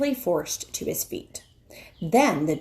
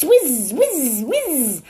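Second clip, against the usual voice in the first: knife whizz; 7 semitones higher, 5 dB louder, much slower than usual. Acted. Imitating knife sharpening.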